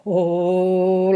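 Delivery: drawn-out astonished "oh"; mock wide-eyed amazement; Baby Bear's gasp. Shown as a demonstration. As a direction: gasp a long oh of shock. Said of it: "like this".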